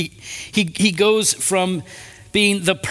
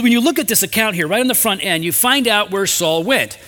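ordinary delivery

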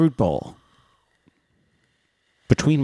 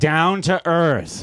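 drawn out slowly; slow mocking; 3 semitones higher, much slower than usual. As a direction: drag it out, mocking. Mocking profile cliché.

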